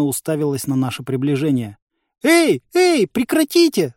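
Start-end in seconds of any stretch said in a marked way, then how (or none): 2.2-3.9 very high, loud cries; alarmed shouting. A narrator's reading. Village head tries to stop the fight, alarmed shouting. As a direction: cry out to halt them. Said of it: "закричала она".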